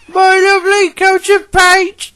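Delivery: very high, loud lament; high-pitched cry of dismay; James crying out. A narrator's reading. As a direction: wail over ruined finery. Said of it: "he cried".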